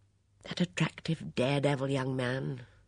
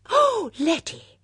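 high, loud, drawn-out cry; sudden excited outburst; exclaimed; 9 dB louder, slower than usual. A narrator's reading. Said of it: "exclaimed Miss Bunner".

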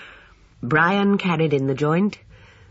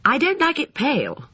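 put-on high boyish voice, mildly anxious; 12 semitones higher than usual. A narrator's reading. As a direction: fret a little in a high child's voice. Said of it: "said Alexander anxiously".

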